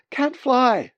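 loud, high, desperate cry; panicked protest. Acted. Bird tells his brain it's hopeless, panicked. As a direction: wail it as a frantic objection.

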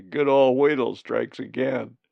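loud, sniggering jeer. A narrator's reading. Scratch mocks Colin being tricked, sniggering. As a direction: snigger first, then crow it out loudly. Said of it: "Scratch sniggered".

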